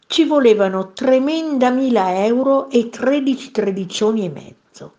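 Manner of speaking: plain reading voice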